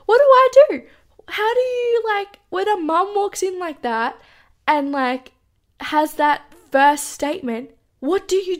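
high, loud panicked rush of questions; spiralling in alarm at the angry mum; panicked thoughts. Retold or imitated. Hiding under covers as his angry mum storms in, panicked.

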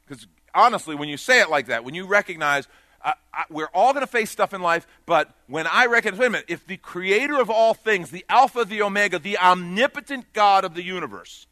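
loud, driving preacher's call; forceful preaching. Acted. Preacher urging the congregation to decide, loud and driving.

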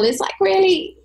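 trebly voice. Shown as a demonstration. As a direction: squeeze it into the treble. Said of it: "if you put too much treble".

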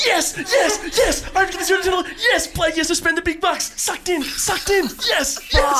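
excited shouting. Real, not acted. Wins the cheap gift draw.

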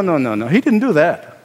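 ordinary talk, nothing marked

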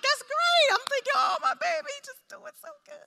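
high, gushing proud-mother voice; doting mom. Retold or imitated. Mom gushing inwardly over her son at church, high doting voice.

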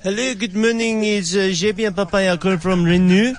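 put-on French accent. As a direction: greet the client as a mock French boss. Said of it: "such a French accent".

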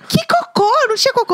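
very high mimicked bafflement; squeaky-high retold disbelief; incredulous denial. Imitates husband's clueless denial.

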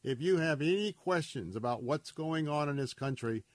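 ordinary talk, nothing marked

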